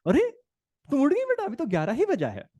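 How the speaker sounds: a syrupy sarcastic wake-up greeting; wife teasing the late-rising daughter, high and sarcastic; sarcastic mother. Retold or imitated. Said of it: "my wife ने sarcasm मारा".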